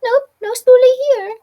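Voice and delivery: high, loud squeaky voice pretending not to be there; squeaky hiding Spoolie. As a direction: squeak out an obvious fib from the bushes. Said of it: "I know your squeaky voice anywhere".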